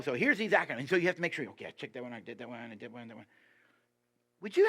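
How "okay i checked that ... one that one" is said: mock checklist prayer.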